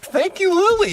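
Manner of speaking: put-on very high-pitched praise to a child; gushing thanks. Patting niece on the back for her suggestions, gushing. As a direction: play up the thanks, voice way up.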